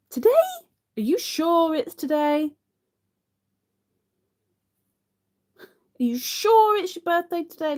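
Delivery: high, loud astonished questions; can't believe it's today; surprised disbelief. Real, not acted. Told it's a viewer's birthday, high astonished disbelief.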